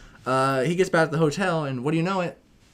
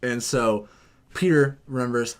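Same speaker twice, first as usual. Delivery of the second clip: ordinary talk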